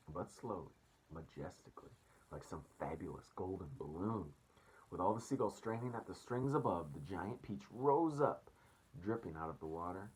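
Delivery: plain reading voice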